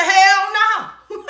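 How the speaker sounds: high, drawn-out imitation of mama; mother's sudden outburst. Daughter imitating mama busting out of a trance, high outburst. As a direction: burst out with it, amused retelling. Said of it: "she bust out that damn trance".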